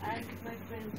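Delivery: faint and distant, far from the microphone; off mic. Real, not acted. Audience member asking the panel before the mic arrives, faint and distant. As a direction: speak from the back of the hall without a mic. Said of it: "Can you kindly bring the mic?".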